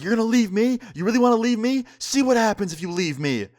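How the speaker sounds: high-pitched mock threat; imitated threatening girlfriend. Storyteller imitating a girlfriend threatening to hurt him, mock menace.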